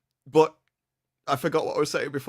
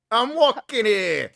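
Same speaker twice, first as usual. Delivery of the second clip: put-on very slow, much higher and louder New York accent; 8 semitones higher, 3 dB louder, much slower than usual. Cohost shouting her favourite New York phrase, hammed-up. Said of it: "it's my favorite phrase".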